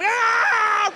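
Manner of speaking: long, high, loud scream; imitated dog attack scream. Dog running at another guy, screaming. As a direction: let out a sustained attack yell.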